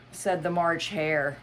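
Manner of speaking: usual speaking voice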